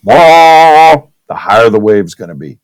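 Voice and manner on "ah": loud, high held "ah"; energetic loud "ah". Shown as a demonstration. Teacher showing more energy makes higher waves, loud "ah". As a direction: push out a big "ah". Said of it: "the more energy I put into my voice".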